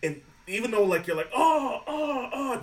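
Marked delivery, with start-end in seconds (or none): 1.3-2.6 laughing mock gasp. Mock reaction to creepy contortionist.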